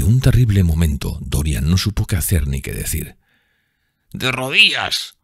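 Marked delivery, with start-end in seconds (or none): 4.1-5.2 growled order. A narrator's reading. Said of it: "gruñó su agresor".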